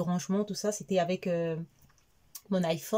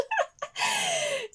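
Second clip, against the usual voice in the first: squealing laugh; high-pitched laughter. Real, not acted. Rire au milieu du récit. (éclat de rire aigu)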